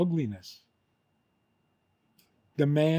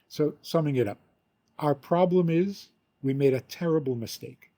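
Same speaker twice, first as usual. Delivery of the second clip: ordinary talk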